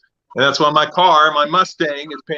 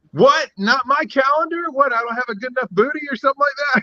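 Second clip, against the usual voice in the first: high-pitched mock indignation; put-out whine, much higher than usual; mock offended; 9 semitones higher than usual. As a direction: play the hurt, indignant buddy. Acting out miffed buddy's reaction.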